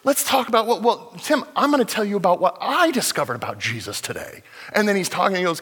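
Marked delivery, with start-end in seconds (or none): 0.0-4.4 high, excited retold outburst; delighted Hector. Pastor retelling Hector's delight to the congregation, excited and high. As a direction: bubble over with joy about Jesus.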